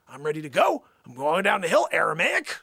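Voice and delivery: comic loud grievance; exasperated. Acted. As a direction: push it up into a disbelieving question. Acted exasperation at Aramaic.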